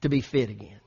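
loud, emphatic pulpit voice; full-force preaching. Real, not acted. Pastor sharing his fitness resolve with his church, preaching loudly. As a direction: drive it home to the congregation.

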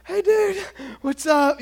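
high, loud, gasping greeting; mock breathless greeting; out of breath. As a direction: act winded from running, forcing a casual hello. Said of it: "he's out of breath".

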